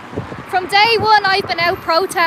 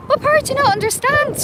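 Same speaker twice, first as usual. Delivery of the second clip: much higher, faster, pushing the question; exasperated, higher and faster; exasperated repeat; 8 semitones higher, faster than usual. Real, not acted. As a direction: demand it again, fed up and pitched way up.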